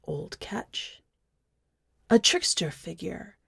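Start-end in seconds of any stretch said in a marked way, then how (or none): 0.1-1.0 soft unvoiced whisper of the name. A narrator's reading. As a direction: breathe the name like a secret. Whispered aside in narration.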